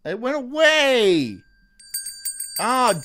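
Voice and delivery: high, loud, slow frustrated cry; frustrated outcry. Real, not acted. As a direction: wail that the sneeze vanished. Sneeze disappears.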